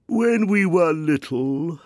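slow, high, woeful creature voice; a touch of sobbing; mildly tearful Mock Turtle. A narrator's reading. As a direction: calmer but still close to tears. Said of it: "still sobbing a little".

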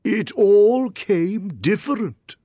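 high, slow, pondering Mock Turtle; thoughtful. A narrator's reading. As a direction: mull it over slowly in creature voice. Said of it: "repeated thoughtfully".

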